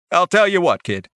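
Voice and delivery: an Australian vendor making a deal; Australian accent. A narrator's reading. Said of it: "He had an Australian accent".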